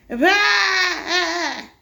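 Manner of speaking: high, loud goat bleat; irritated bleat. Acted. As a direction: bleat in angry protest. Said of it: "irritated bleat".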